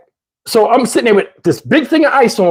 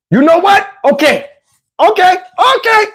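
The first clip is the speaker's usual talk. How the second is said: mock threat; 9 semitones higher than usual. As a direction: play him huffing before a call. Man threatening to call.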